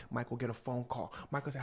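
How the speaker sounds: normal talking voice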